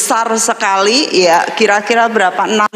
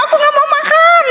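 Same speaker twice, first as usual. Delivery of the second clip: high whiny no; mock tearful refusal; faster than usual. Heartbroken girl refusing to eat, high pouty whine. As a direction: pout it like a sulking girl.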